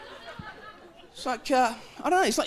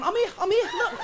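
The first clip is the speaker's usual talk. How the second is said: talking cornflakes; 8 semitones higher than usual. Pretend. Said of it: "cornflakes on the shelf going".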